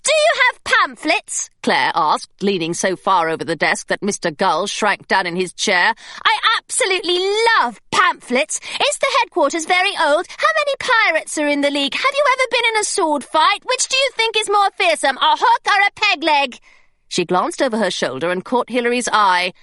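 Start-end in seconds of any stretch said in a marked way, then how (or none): 0.0-1.5 put-on much higher, gushing girl voice; eager schoolgirl. A narrator's reading. Claire gushing at the secretary. 6.2-16.6 put-on much higher, gushing girl voice; eager schoolgirl. A narrator's reading. Claire gushing at the secretary.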